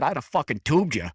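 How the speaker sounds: put-on loud Boston accent quote; gruff Boston guy, high. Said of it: "in that Boston accent".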